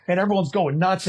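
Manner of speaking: usual speaking voice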